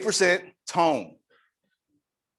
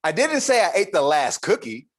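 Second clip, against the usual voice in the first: a different word pressed, a bit louder; shifted stress; 5 semitones higher, 4 dB louder than usual. Shown as a demonstration. Speaker demonstrating eight meanings, louder shifted stress. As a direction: push the stress further along. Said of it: "said eight different things".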